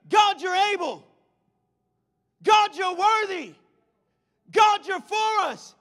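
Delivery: high, drawn-out shout of praise; loud scream. Acted. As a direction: scream it out loudly to God. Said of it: "began to scream loudly".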